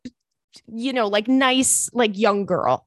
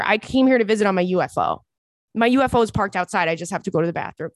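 rushed alien joke; faster than usual. Retold or imitated. Comedic alien mock line.

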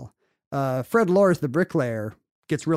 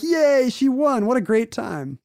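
mock cheering crowd; 9 semitones higher, slower than usual. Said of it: "They're like".

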